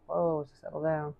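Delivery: ordinary talk, nothing marked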